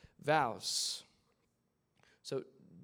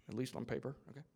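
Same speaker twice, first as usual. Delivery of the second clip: ordinary delivery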